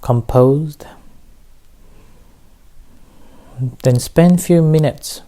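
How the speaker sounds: measured meditation teacher with long pauses; unhurried instructions, leaving room to breathe; guided meditation. Real, not acted. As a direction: guide listeners into relaxation, phrase by phrase.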